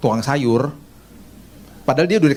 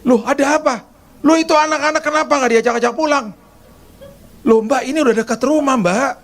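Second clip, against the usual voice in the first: much higher, nagging, indignant; scolding maid; 11 semitones higher than usual. Retold or imitated. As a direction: nag like a bossy housekeeper. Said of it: "kayak gitu loh ngomongnya".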